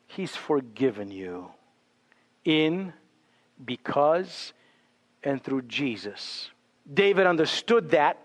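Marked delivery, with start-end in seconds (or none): 0.1-6.5 much lower and softer, almost hushed; tender assurance. Real, not acted. Preacher assuring the congregation of pardon, hushed and tender. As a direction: let it fall gently, reassuring.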